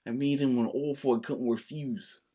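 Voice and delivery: slow, low, soft Godfather voice; mob boss impression. Trying the Godfather line for co-host, slow soft impression. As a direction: imitate the don, unhurried and hushed. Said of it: "Can you do it?".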